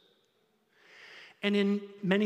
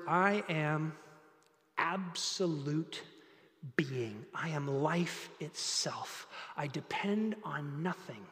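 softer, lower and slow; hushed awe; 4 semitones lower, 7 dB quieter, slower than usual. Acted. Pastor voices God's self-existence, softly and slowly. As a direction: drop to a reverent near-hush. After climax, hushed God voice.

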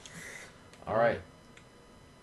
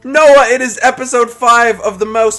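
announcer; 11 semitones higher, 13 dB louder than usual. Acted. Loud announcer intro.

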